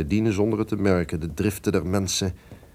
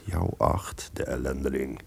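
whispered sneer; 7 semitones lower, 6 dB quieter than usual. Acted. Duke sneers at the traitor.

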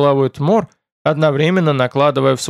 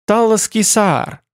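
drawn out, higher-pitched pronouncement; proud announcement; 7 semitones higher, slower than usual. A narrator's reading. Servant proudly naming the rare wood to a guest, proud and drawn out. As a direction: announce the name slowly and proudly.

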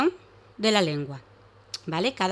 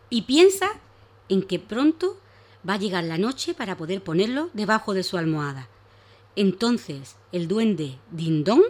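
story narration; 3 dB louder than usual. Acted. Reads a story to children.

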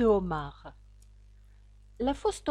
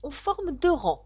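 mild cry, higher pitched; calling out; 5 semitones higher, 4 dB louder than usual. A narrator's reading. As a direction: call it out with restraint. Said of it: "cria la fausse tortue". (s'écrier avec retenue, plus aigu)

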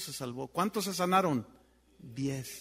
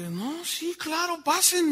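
higher, eager wife's welcome, retold; 10 semitones higher, 4 dB louder, slower than usual. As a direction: wave them in with a raised, eager voice. Esposa invita a pasar, retold.

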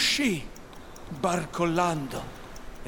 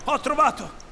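high, loud triumphant call; suddenly inspired; 12 semitones higher, 5 dB louder than usual. A narrator's reading. Hook lights up and calls his crew, excited shout. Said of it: "si illuminò".

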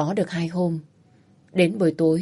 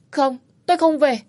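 higher, louder frightened defiance; wife refusing her violent husband; defiant refusal; 7 semitones higher, 3 dB louder than usual. Acted. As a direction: refuse him, voice up and pushing back.

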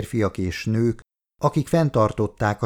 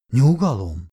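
raised a touch, commanding; firm call for calm; 3 dB louder than usual. A narrator's reading. Husband calming the men holding his wife, firm call. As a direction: cut through the struggle.

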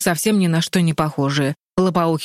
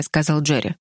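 ordinary delivery, nothing marked

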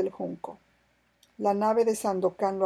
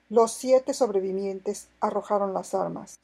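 ordinary delivery, nothing marked

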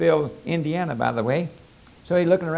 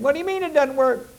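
puzzled coon; 11 semitones higher, faster than usual. Acted. Male coon puzzled question.

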